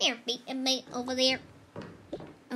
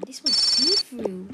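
excited; 3 semitones lower, 9 dB louder, slower than usual. Pretend. Amazed find.

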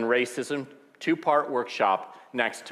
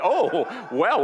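laughing, rising high; 8 semitones higher, 5 dB louder than usual. Real, not acted. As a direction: laugh through the words. Laughs at interruption.

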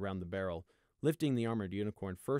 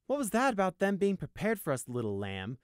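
mocking high taunt; smirking; 9 semitones higher, 4 dB louder than usual. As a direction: tease him with a smirk. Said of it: "with a smirk".

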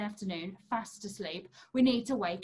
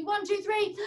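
excited shout; 8 semitones higher than usual. Acted. Counting down for the children.

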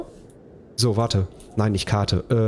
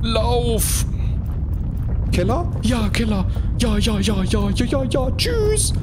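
being chased; 10 semitones higher, slower than usual. Real, not acted. Rushing to shelter in panic.

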